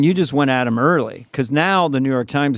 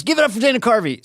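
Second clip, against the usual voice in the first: hype announcer; 9 semitones higher, faster than usual. Retold or imitated. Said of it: "And he goes".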